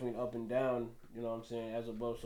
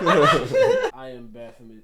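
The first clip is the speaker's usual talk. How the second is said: demon voice; 3 semitones higher, 16 dB louder, much slower than usual. Pretend. Demonic name intro, slowed.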